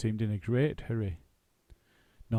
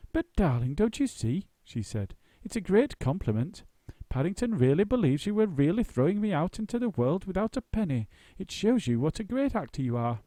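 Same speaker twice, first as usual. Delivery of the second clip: actress voice; 8 semitones higher, 4 dB louder than usual. A narrator's reading. Sarah laughing.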